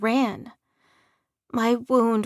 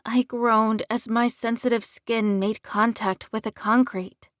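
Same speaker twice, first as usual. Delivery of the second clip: usual speaking voice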